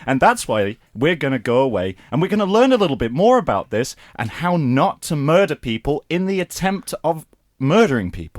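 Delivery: upbeat cartoon voice for kids; cartoon host. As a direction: sell it like a kids' show presenter. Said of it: "Saturday morning cartoon".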